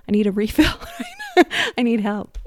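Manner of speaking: laughing through the words. Real, not acted. Asking God for a refill, laughing. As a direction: let the laugh break in. Laughs at own coffee joke.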